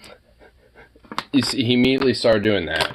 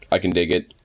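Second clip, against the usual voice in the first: normal talking voice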